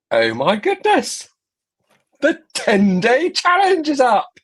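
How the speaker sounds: high-pitched, thrilled reveal; excited announcement. Real, not acted. Telling viewers the ten-day challenge is up, excited. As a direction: build to a big excited reveal.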